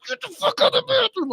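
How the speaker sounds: strained, very high retold shriek; frantic mock screech at reduced volume; mad yelling. Dad ordering daughter out of the bathroom, high mad screech. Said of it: "I got so mad".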